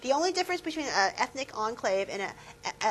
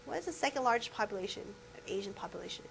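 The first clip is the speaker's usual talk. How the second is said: quick unvoiced whisper of the question; 8 dB quieter, faster than usual. Real, not acted. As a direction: whisper it fast as an aside. Teacher repeats question whispered.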